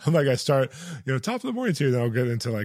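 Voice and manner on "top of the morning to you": a hammed-up stock Irish greeting, pitched way up; lightly voiced Irish accent, played for laughs; mock Irish accent. Host jokes about mixing up Irish while trying Irish accent. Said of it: "if you asked me to do Irish".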